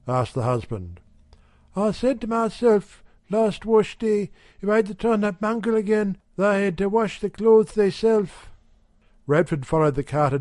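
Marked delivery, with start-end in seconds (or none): 1.7-8.5 lightly higher wife's voice; a hint of a brisk housewife; Mrs Radford's voice. A narrator's reading. Wife telling the shopkeeper, lightly higher housewife voice.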